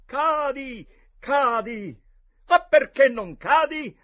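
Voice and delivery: high, loud, drawn-out demand; exasperated shout. A narrator's reading. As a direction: yell at your opponent to fall. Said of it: "Ben presto Tom gridò". (grido esasperato)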